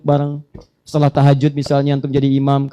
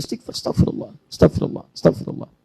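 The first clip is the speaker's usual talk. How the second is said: whispered dhikr; 3 semitones higher than usual. Shown as a demonstration. Preacher shows the congregation how to whisper istighfar. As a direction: breathe the words out in a whisper. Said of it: "ini perbanyak oleh Anda".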